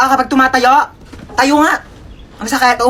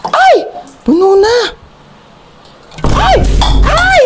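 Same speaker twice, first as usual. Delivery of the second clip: much higher, louder yelps; startled shrieks; 13 semitones higher, 3 dB louder, much slower than usual. Acted. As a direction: shriek in sudden alarm. Shrieking in panic. (gulat na sigaw)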